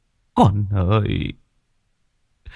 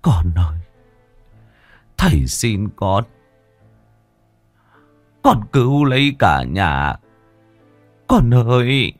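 tearful father pleading. Acted. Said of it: "cúi mặt mà khóc mếu".

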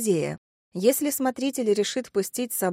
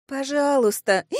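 high drawn-out plea ending in a hiccup; tipsy pleading; 6 semitones higher, 4 dB louder than usual. Acted. Drunk Kelsey begs a stranger for help, pleading. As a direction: add a drunken, pleading please. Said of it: "дополнила Кэлси".

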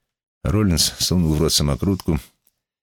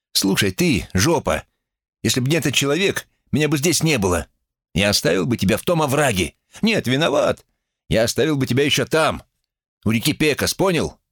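much higher, heated voice; angry telling-off; 10 semitones higher than usual. A narrator's reading. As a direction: tear into him, pitch jumping up. Acted quarrel, threat to the boy. (сердитая отповедь)

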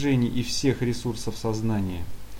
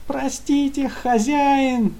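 high whimpering minion voice; whining; 5 dB louder, much slower than usual. Acted. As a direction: whimper it, begging for mercy. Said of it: "скулил".

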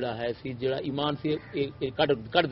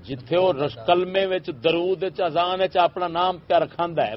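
loud, raised-pitch indignation; indignant rant; 6 semitones higher, 6 dB louder than usual. Real, not acted. Critic denouncing a man's name in the kalma, indignant. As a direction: list each outrage loudly and forcefully.

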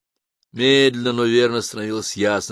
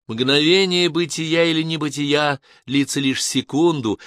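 higher, slowed lecturing voice; preachy, mentoring tone; 8 semitones higher, 4 dB louder than usual. A narrator's reading. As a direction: pronounce it slowly like a lofty maxim. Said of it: "возненавидела собственный менторский тон".

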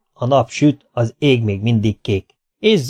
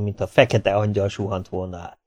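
ordinary delivery, nothing marked

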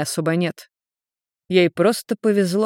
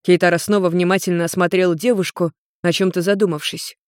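ordinary delivery

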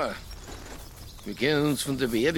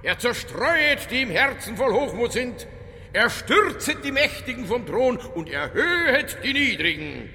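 declaiming prayer; 7 semitones higher than usual. Acted. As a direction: proclaim the Magnificat defiantly. Detective declaims the Magnificat. (pathetisches Deklamieren)

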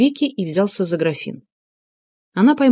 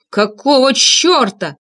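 outraged roar, drawn out and higher; slow, heavy exclamation of fury; 6 semitones higher, 3 dB louder, much slower than usual. A narrator's reading. Tarasov giving vent to his feelings, roaring. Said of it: "взревел он".

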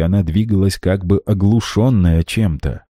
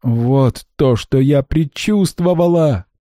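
higher, anguished exclamation; cry of dread; 8 semitones higher, slower than usual. A narrator's reading. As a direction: cry out as her fear comes true. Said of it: "вскричала Ванда". (вскрик ужаса)

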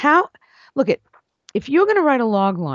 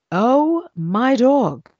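awestruck; 5 semitones higher, much slower than usual. Real, not acted. Awed exclamation about the script.

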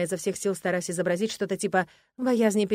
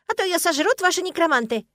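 high, loud squirrel voice; squirrel squeak; 10 semitones higher, 6 dB louder than usual. A narrator's reading. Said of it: "раздался знакомый беличий писк". (громко и пискляво, как белка)

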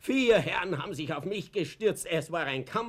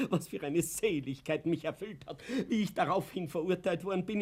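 usual speaking voice

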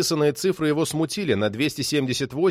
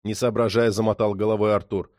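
ordinary delivery, nothing marked

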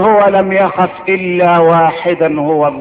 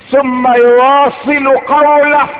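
ordinary delivery

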